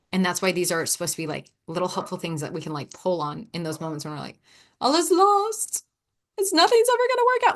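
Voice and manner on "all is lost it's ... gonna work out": hammed-up hopelessness, pitched way up; mock despair. Speaker voicing being at her limits, mock despairing wail. Voices an emotionally compromised moment.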